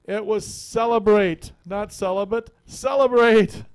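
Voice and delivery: high, slow, loud lament on celebrate; anguished old saint. Acted. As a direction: wail the punchline like a heartbroken old monk. Augustine's punchline anguished outcry.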